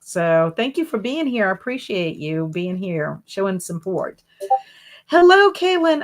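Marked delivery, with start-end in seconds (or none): 5.1-6.0 excited greeting. Real, not acted. Greeting a viewer in live chat.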